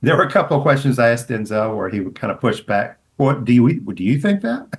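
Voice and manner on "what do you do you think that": retold probing question. Retelling her own pushy question.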